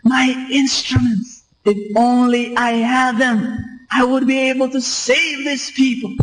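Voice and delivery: high desperate cry, level held; retold shouting. Panicking doctor still shouting for his instruments, high mock shout. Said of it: "he still kept on shouting".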